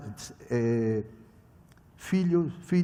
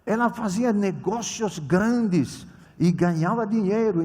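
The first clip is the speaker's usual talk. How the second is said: usual speaking voice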